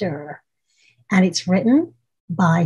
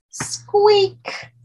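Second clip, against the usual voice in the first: high, tiny squeaky noise; high squeak; 14 semitones higher than usual. Acted. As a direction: squeak like a little mouse. Imitating the squeak sound.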